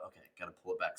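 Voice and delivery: quietly collect yourself after an outburst; calming self-talk. Retold or imitated. Takes a second to calm himself.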